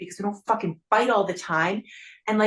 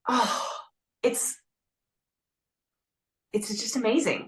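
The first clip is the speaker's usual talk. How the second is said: tearing up. Real, not acted. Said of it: "now I'm gonna cry again".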